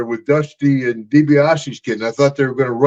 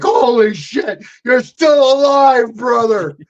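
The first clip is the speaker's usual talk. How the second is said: mock shouted greeting; 9 semitones higher, slower than usual. Wrestler greeting an old friend, a slow hammed-up shout. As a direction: bellow it like a buddy you thought was gone.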